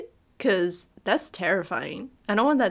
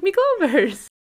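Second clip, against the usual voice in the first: leprechaun voice; 9 semitones higher, 5 dB louder, slower than usual. Retold or imitated. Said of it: "He's like".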